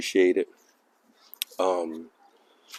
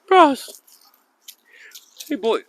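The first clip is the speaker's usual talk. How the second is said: excited dog call; 5 dB louder, slower than usual. Real, not acted. Calling dog Frost.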